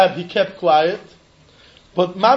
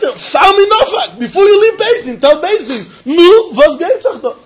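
raised, high-pitched demand to the judges; insistent appeal; 6 semitones higher, 4 dB louder than usual. Acted. Litigant appealing to Beis Din before leaving, insistent. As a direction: press the court for an answer, voice up.

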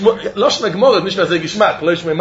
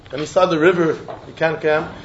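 ordinary delivery

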